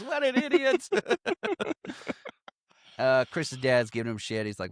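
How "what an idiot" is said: high-pitched, amused jeer; mock him while cracking up; laughing put-down, voice jumping up high; imitated mocking laughter. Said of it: "just laughs his ass off and goes".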